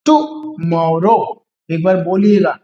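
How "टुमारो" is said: Tomorrow stretched out very slowly, syllable by syllable; slow pronunciation demo. Shown as a demonstration. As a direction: drag tomorrow out slowly so the class can copy it. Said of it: "ये बनेगा इसका pronunciation".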